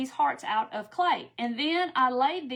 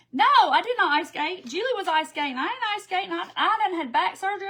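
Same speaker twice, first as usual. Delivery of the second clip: louder and higher, mock-offended protest; indignant denial; 6 semitones higher, 5 dB louder than usual. Real, not acted. Answering a viewer's joke, loud playful indignation. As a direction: set the record straight with playful outrage.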